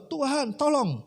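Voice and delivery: high-pitched acted plea to God; pleading prayer. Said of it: "Dia naik ke genteng berdoa". (doa memohon tolong)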